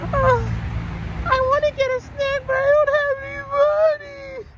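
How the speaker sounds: teacher imitating a girl asking for snack money, high and pleading; whiny kid. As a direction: beg sweetly like a little girl.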